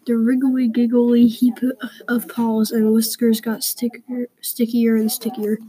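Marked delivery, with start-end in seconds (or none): none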